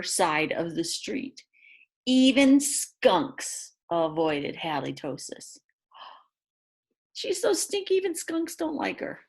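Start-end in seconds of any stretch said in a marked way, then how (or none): 5.9-9.2 high squealing mock disgust after a gasp; gasp, then squeal how stinky she is. Reader reacting to the smelly dog for the kids, squealing high.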